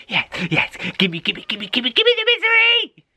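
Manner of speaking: climbing to a shrill high cry of gimme; frantic begging. Acted. Cat begging the old lady for misery, frantic.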